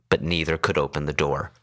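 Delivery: ordinary delivery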